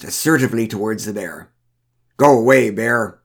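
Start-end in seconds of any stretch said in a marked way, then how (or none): 2.2-3.2 firm, loud command at normal pitch; loud order. A narrator's reading. Woman confronts the bear, ordering loudly. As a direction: order it off with confidence. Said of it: "she ordered loudly".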